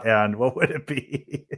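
ordinary delivery

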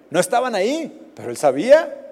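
normal talking voice

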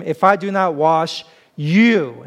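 slow line, landing on a drawn-out YOU; long, emphatic YOU at the end; stress on YOU. Shown as a demonstration. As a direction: put the weight on the last word, YOU. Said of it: "and the emphasis is there".

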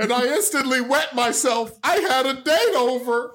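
mock embarrassed, giggling through it; laughing mock embarrassment. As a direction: ham up the shame while laughing. Pretend embarrassed victim.

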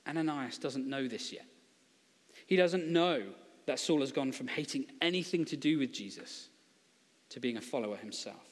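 ordinary delivery

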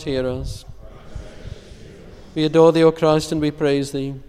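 ordinary delivery

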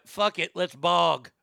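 punchy mock Boston accent. Shown as a demonstration. Reading out the girl's jug slogan, Boston accent. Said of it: "That's how they would say it".

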